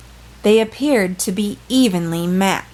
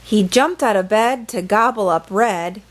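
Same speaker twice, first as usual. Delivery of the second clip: usual speaking voice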